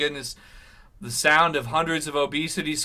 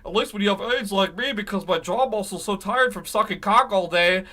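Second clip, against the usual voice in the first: mocking impression; 5 semitones higher than usual. Mocking the presenter's voice.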